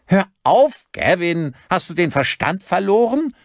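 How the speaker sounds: high, loud, horrified protest; frantic high-pitched yell; appalled shouting. A narrator's reading. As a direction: shout to stop the madness. Rosenbaum shouts to stop shooting.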